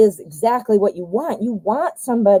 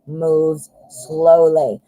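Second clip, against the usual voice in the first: drawn out at half speed, lower; slow-moving; 5 semitones lower, much slower than usual. Shown as a demonstration. Reader describing the slow Knight of Pentacles, deliberately slow. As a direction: plod through it word by word.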